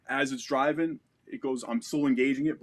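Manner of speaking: ordinary talk, nothing marked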